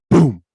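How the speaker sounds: loud, sudden vocal boom; impact imitation. Man recreating a street accident, explosive boom. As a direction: punch out the crash noise.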